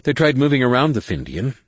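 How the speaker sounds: ordinary delivery, nothing marked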